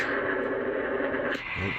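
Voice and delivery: normal talking voice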